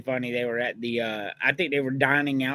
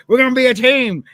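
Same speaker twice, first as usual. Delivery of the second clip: loud, higher hammed-up shout of the line; Stone Cold impression; 8 semitones higher, 10 dB louder than usual. Retelling Stone Cold rallying the team, loud mock shout. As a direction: yell it at the locker room. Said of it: "he turned around and he goes".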